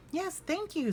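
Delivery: high, polite cub voice; sweet small voice, minding manners; little bear voice. Acted.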